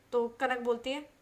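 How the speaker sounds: ordinary delivery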